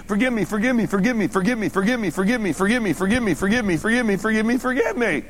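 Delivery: rapid unbroken rattled-off chant; mock someone begging over and over without a pause; repetitive pleading. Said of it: "You don't have to keep saying".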